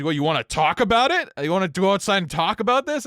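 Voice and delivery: squaring up, retold; confrontational challenge. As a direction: imitate a drunk picking a fight. Acted drunk challenging to a fight.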